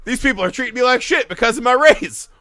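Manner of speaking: high, mock-indignant outburst; mock Freddie Prinze Junior. Quoting him on being treated badly, hammed-up indignation. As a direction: build to a loud, high mock protest. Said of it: "But he literally says".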